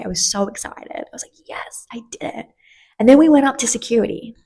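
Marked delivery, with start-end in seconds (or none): 0.0-2.5 hushed, thrilled whisper; giddy whisper; excited whisper. Real, not acted. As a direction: whisper it like a secret win.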